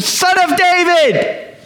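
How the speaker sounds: high, drawn-out call into sudden silence; lone yell caught mid-hush; crying out. Acted. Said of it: "and then this hush".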